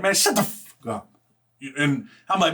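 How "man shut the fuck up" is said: nasty retort. Retold or imitated. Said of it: "I got real nasty back".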